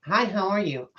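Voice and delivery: high, bright retail greeting; chirpy greeter impression. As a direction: play the overly cheerful greeter.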